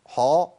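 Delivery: "حا" with a dark back vowel; heavy "haw" for "حا"; wrongly heavy ha. Shown as a demonstration. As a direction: thicken "حا" into "haw". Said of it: "ما ينفعش".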